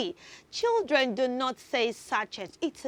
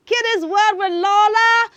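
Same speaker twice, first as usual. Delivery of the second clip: loud, bright announcer read of the show name; big TV kids-show announcer voice; show tagline; 7 semitones higher, 9 dB louder than usual. Acted.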